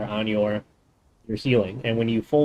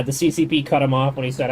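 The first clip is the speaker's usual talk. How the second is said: usual speaking voice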